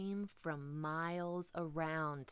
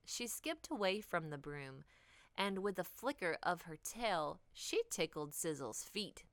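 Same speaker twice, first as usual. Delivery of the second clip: usual speaking voice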